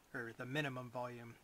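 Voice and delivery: normal talking voice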